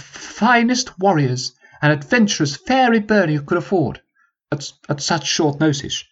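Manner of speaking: a high, formal commanding officer; rally the troops like an old sergeant; sergeant's voice. Acted.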